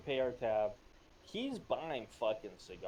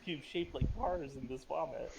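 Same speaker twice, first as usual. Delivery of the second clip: ordinary talk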